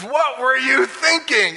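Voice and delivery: high, slow exasperated question; hammed-up sailor scolding; incredulous scold. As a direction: drag it out in disbelief.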